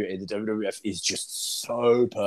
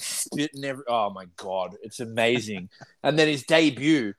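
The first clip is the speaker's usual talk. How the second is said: ordinary delivery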